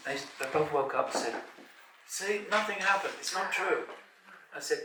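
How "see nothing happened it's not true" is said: put-on teen girl. Girls claim regression failed.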